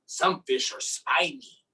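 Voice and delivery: ordinary delivery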